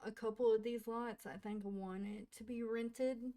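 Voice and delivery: normal talking voice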